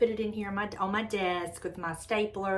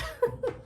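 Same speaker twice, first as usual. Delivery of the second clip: high giggle. Real, not acted. Laughs at blaming Julie.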